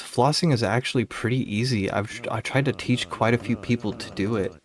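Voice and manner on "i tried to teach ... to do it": ordinary talk, nothing marked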